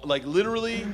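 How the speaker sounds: ordinary delivery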